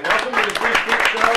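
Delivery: loud, high announcer call; rousing show welcome. Real, not acted. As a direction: belt out the welcome to the crowd. Host opens show to applause.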